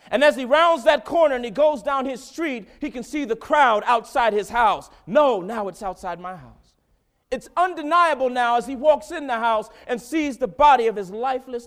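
loud, high preaching build; full-force preaching. Real, not acted. Preacher rousing the congregation, loud and high. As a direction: preach it out to the church.

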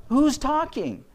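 high-pitched, startled question; retold astonishment; surprised at oneself. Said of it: "I was almost surprising myself".

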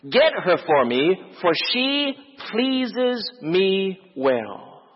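Samson's reply, measured and drawn out; slow, deliberate quote. Acted. Preacher quotes Samson answering his parents, slow and deliberate. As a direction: quote it slowly, drawing out the words.

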